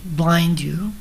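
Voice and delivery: usual speaking voice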